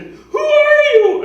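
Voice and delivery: very high, drawn-out, hammed-up scream; mock terrified yell. Imagined intruder confronting the superhero, hammed-up high yell. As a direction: cry it out slowly in mock alarm.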